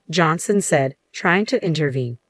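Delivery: ordinary delivery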